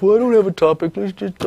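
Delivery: a hint of a sheepish caller-host voice; mock version of himself. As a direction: poke fun at his own unprepared self. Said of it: "not do my usual thing of".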